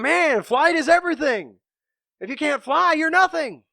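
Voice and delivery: high, smug put-down; arrogant bird. Retold or imitated. Bird telling the rhino he is nothing without flight, smug and high. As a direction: talk down like a know-it-all.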